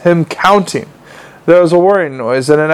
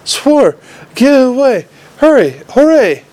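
confused yelling; 9 semitones higher than usual. A narrator's reading. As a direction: blurt each word out in a daze. Vimes waking confused, yelling.

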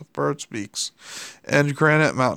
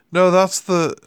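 irritable; 5 semitones higher, 6 dB louder than usual. A narrator's reading. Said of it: "snapped his fingers irritably".

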